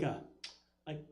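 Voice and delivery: normal talking voice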